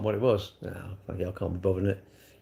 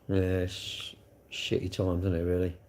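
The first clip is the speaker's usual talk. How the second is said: slow, soft and low; sad; 5 semitones lower, 8 dB quieter than usual. Real, not acted. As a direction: let the grief weigh it down, slowly. After sigh, talking of lost parents.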